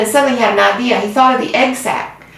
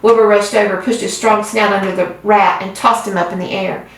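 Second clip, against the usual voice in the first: ordinary delivery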